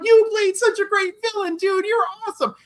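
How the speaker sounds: high, gushing fan praise; squealing starstruck fan, near tears. Retold or imitated. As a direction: fawn over him like a starstruck fan. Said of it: "I'm like".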